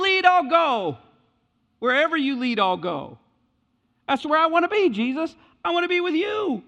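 pleading healed man. Acted. Healed man begging Jesus to take him along, crying out high. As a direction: plead desperately to follow him. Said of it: "this man, who is now restored".

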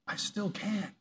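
soft, hushed calm reply; Jesus quietly answering the mourners, softly; quiet assurance. Retold or imitated. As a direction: drop to a hush, unshakable. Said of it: "he's like".

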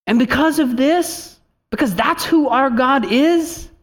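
rising preacher's climax; full-force preaching. Real, not acted. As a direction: build the crowd up to the peak.

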